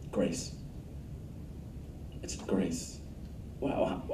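grace said low and very soft; hushed answer. Real, not acted. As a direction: let the word land gently, almost reverent. Sermon's quiet key answer.

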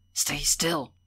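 tense, urgent hushed order, half voiced; urgent warning with a mock-whisper edge; fierce whisper, voiced. A narrator's reading. Will warns Horace to stay hidden, fierce urgent undertone. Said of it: "he whispered fiercely".

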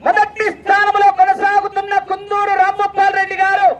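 high, level-pitched announcing call; race announcer. Real, not acted. Announcer calling bullock race round result to crowd, high chanting call. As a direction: ring out the round result on one high note.

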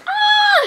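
high, loud squeal of delight; real excited shriek; happy squeal. Real, not acted. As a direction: squeal as you hug a friend. Best friend hug squeal.

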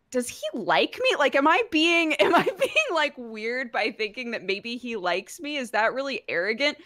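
high, giggly mock fretting; anxious self-questioning. Voicing a character's anxious self-doubt.

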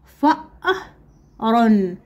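"فأر" very slowly, broken into syllables. Shown as a demonstration. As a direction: sound out "فأر" with a gap after the hamza. Teacher sounds out word for pupils.

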